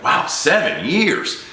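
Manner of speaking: slow, high-pitched awed disbelief; imitated amazement. Insurance agent hearing how long the couple lasted, drawn-out amazement. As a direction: stretch it out in mock astonishment.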